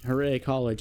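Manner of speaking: ordinary talk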